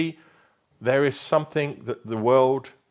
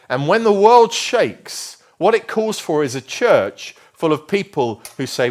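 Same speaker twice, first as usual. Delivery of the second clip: preaching loud; 7 semitones higher, 7 dB louder than usual. Real, not acted. Preaching at full force.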